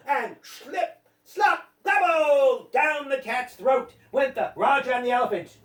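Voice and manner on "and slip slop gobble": drawn-out, punched gulping words; gobbling refrain. A narrator's reading. Narrator as the cat swallows them, gobbling. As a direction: stretch each word like a big swallow.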